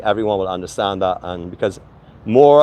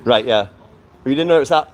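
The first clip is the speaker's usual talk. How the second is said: usual speaking voice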